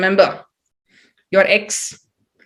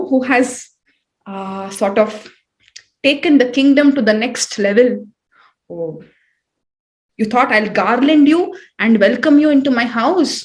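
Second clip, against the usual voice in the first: ordinary delivery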